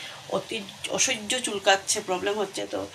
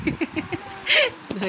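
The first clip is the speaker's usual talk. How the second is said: short high amused laugh; a quick giggle at herself; light laugh; 8 semitones higher than usual. Real, not acted. Laughs at own cooking remark. (হালকা হাসি)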